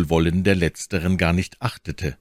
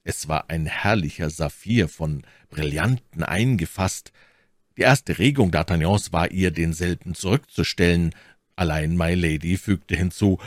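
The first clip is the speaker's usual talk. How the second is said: usual speaking voice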